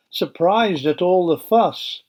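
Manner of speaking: ordinary delivery, nothing marked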